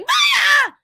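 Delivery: high, loud command shout; yell. A narrator's reading. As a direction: yell to start the flying sneakers.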